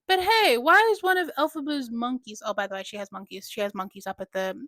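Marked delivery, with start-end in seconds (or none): 0.1-2.4 alarmed call. Retold or imitated. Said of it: "He's like".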